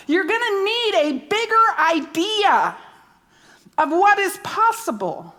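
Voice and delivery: rising, fuller preacher voice; preaching with growing force. Acted. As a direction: build it up for the congregation. Preaching builds after whisper.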